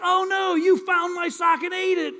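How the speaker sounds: high, loud, horrified cry; horrified shout. Retold or imitated. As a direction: shriek it as the awful truth hits. Bride discovers groom's awful breath.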